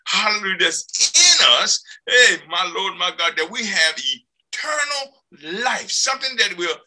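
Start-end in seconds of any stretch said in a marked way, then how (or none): none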